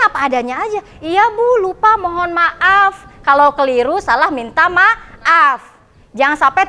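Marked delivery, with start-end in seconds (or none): 1.0-3.1 high, drawn-out apology; apologetic student. Acted. Student admitting forgetfulness to the teacher, apologetic. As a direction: own up to the teacher, pitched up and slowing.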